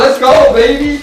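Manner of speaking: loud, high hype cheer. Acted. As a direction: cheer your buddy on. Celebrating friend's lift.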